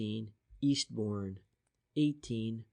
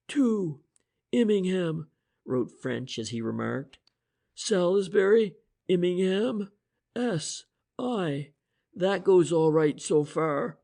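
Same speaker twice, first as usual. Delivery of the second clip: higher, brisk, assured inspector voice; confident inspector; 6 semitones higher, 5 dB louder than usual. Acted. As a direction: lay out the theory with energy. French's voice again.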